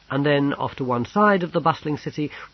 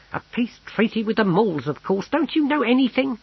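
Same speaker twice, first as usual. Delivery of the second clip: high-pitched, incredulous scolding; scornful clerk; 11 semitones higher, 3 dB louder than usual. Acted. Clerk corrects ignorant Snibrill, scornful. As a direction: climb higher into the exasperated question.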